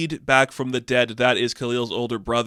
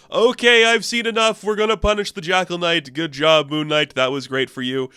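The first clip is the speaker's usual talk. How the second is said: much higher and louder, grand pronouncement; booming god voice; 6 semitones higher, 4 dB louder than usual. Retold or imitated. Osiris praising Moon Knight in a booming voice. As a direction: announce judgment like a god.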